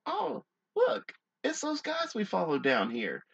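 high, put-on voice sliding down; squeaky-high mock discovery; mock car occupant. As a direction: play an oblivious onlooker spotting someone.

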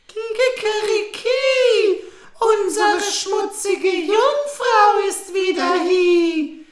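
high, loud, slow rooster call; rooster crow. Acted.

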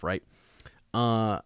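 ordinary talk, nothing marked

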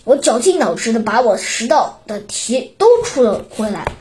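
plain reading voice

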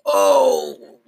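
long, winded groan; drawn-out "oh" of pain. A narrator's reading. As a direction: groan as if punched in the stomach. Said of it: "Chris groaned".

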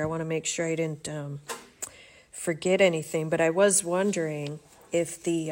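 ordinary delivery

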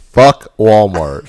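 loud. Retold or imitated. Echoing the Walmart quitter.

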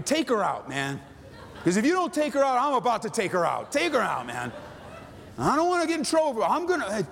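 high, worked-up pleading threat; mock vengeful prayer. Acted vengeful prayer about an ex.

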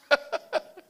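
short high burst of laughter; high chuckle. Real, not acted. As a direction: laugh at the memory. Speaker laughs at own story.

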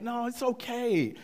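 high, falsely reassuring dad; passive-aggressive. Retold or imitated. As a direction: pretend it's fine when it isn't. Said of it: "passive aggressively, I said".

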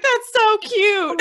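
high, slow squeal of delight; drawn-out gushing squeal. Retold or imitated. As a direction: coo it out like it melted your heart. Gushing over a thank-you note.

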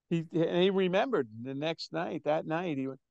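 ordinary talk, nothing marked

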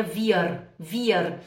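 "wir" with the final R pronounced instead of A; "wir" ending on a pulled, audible R; foreign-sounding R. Shown as a demonstration. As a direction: show the wrong-for-Germany "wir", R sounded at the end. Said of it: "Vai sonar muito estrangeiro".

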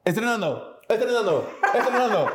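high, fading repeated word like a reverb; mock echo jingle; echo effect. Shown as a demonstration. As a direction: sing it out with a fake echo. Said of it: "Muéstrale, muéstrale. A ver, otra vez".

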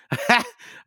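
high burst of laughter. Real, not acted. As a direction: laugh at yourself. Laughs at himself.

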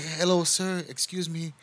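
mock courteous stranger getting attention; polite customer. Strangers addressing his cousin as a store worker, mock polite. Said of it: "they just say, like".

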